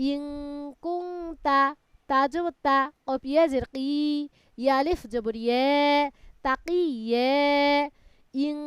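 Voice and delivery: measured recitation with long held syllables; Quran spelling drill. Shown as a demonstration. Teacher leading a student through Quran spelling, measured drawn-out recitation. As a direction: spell out each piece, then draw the joined word out.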